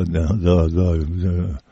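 low, soft mumbled doubt; quiet, acted-out reluctance; doubtful muttering. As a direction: mumble it like someone slowly having second thoughts. Acting out people starting to doubt.

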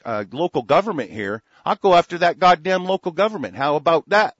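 loud, high, building fury; angry rant. Real, not acted. Host raging at motel clerks, loud furious rant. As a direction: tear into them at full volume.

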